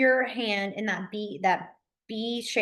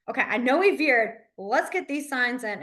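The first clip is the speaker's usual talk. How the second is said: normal talking voice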